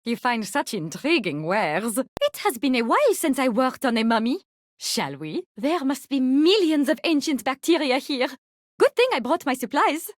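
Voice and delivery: ordinary delivery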